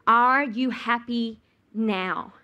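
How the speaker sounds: each word dragged out slowly; slow, drawn-out bitter question. Acted. Wife to husband at his peak, slow and bitter. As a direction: let it hang, slow and bitter.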